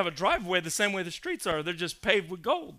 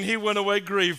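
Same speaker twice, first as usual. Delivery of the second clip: normal talking voice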